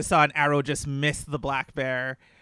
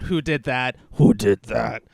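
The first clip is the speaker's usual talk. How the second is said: bear roar; 3 semitones lower, 6 dB louder, slower than usual. Acted. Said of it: "as it roars out".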